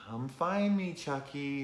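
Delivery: high, teasing sing-song; taunting kid voice. Retold or imitated. Tyler taunting Chucky during hide and seek, high and teasing. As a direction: tease him from behind the couch. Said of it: "he's like".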